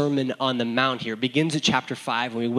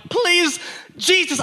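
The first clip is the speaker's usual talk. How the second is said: retold panicked prayer, pitched way up; desperate pleading; slower than usual. Retold desperate prayer after crash.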